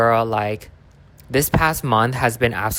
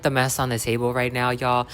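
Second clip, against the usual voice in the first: ordinary talk, nothing marked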